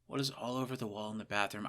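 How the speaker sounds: quiet, to himself; muttering. Real, not acted. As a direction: mutter the question. Repeats question to himself.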